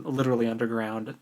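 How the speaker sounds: usual speaking voice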